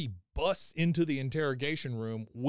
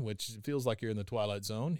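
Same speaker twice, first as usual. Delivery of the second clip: ordinary talk, nothing marked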